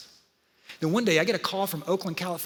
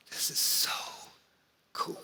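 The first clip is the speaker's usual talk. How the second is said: breathy secret whisper, no voice; excited whisper; 8 dB quieter than usual. Retold or imitated. Daughter pulls dad aside.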